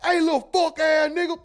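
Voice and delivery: put-on high, loud screaming at the kid; shrieking rage at the kid; furious shout at the kid. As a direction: blow up at the kid off the call. Skit: yelling at kid again.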